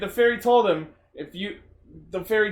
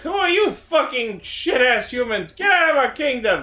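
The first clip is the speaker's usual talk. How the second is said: angry fairy king; 6 semitones higher than usual. Retold or imitated. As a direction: play the furious king throwing them out. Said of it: "King Finvara decides".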